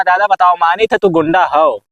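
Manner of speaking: ordinary delivery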